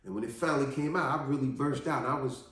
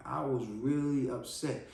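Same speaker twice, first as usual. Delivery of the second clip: ordinary delivery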